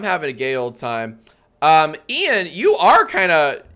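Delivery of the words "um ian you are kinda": excited. Acted. Host hypes contestant.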